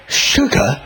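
lightly voiced disapproving woman; a hint of a tutting housewife; mock scolding woman. Woman at number five scolding him over sugar, lightly voiced. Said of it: "And she went".

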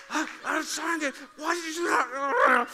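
high, loud strangled anger; hammed-up angry outburst in a restaurant; mock restaurant fury. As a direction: act out being furious in public. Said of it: "you're spitting mad, but you have to be quiet".

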